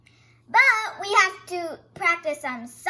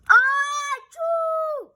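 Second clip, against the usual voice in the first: higher, loud mock sneeze; 7 semitones higher, 6 dB louder than usual. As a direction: sneeze big. Sneezing in the story.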